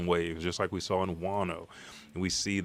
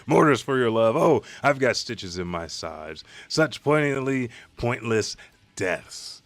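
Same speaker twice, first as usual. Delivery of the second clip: mocking villain; 4 semitones higher, 5 dB louder than usual. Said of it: "he's laughing maniacally".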